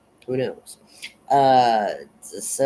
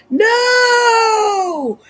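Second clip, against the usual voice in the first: a long, high, loud scream of no; 9 dB louder than usual. Retold or imitated. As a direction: scream it out in full panic. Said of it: "Kathy's screaming".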